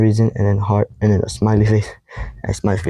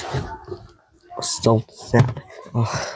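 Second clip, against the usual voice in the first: slow, sorrowful whisper, barely voiced; sad whisper; 7 dB quieter, much slower than usual. Real, not acted. Telling viewers about the lost texts, slow sad whisper. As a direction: let it sink out as a slow whisper. Sad recap of fading friendship.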